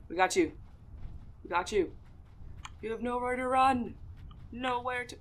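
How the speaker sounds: playfully threatening the rabbit while hunting; mock-menacing hunter. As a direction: growl at the prey like a hammed-up predator.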